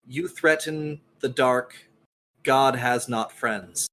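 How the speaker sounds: ordinary delivery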